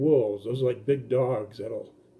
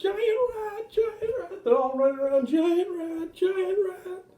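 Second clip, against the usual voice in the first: much higher crying shriek of giant rat; frightened cries. Retold or imitated. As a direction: squeal it while running from the rat. Kids running around screaming.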